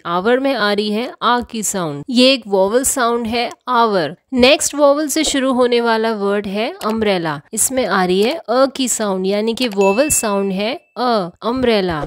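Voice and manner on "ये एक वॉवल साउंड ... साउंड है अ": usual speaking voice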